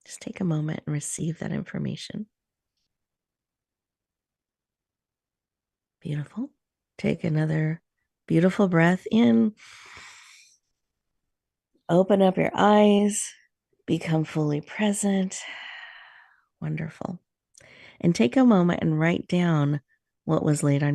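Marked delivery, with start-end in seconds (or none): 0.0-2.3 softer, unvoiced whisper. Guide asking listeners to receive their message, whispered. 6.0-17.2 guided meditation. Acted.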